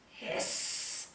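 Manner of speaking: the word hiss with a long trailing sss. Shown as a demonstration. Teacher showing a student how to warn off an attacker, hissed. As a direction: hiss it out, voiceless and soft. Advises hissing, acts the hiss.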